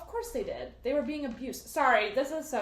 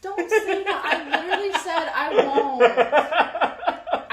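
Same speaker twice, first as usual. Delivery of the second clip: loud laughing protest, slow; 6 semitones higher, 9 dB louder, much slower than usual. Real, not acted.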